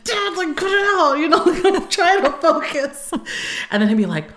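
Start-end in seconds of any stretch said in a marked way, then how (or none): 0.0-3.1 high, loud protest to dad; hammed-up annoyance with a laugh; imitating exasperated teen. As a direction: whine at him to quit distracting you.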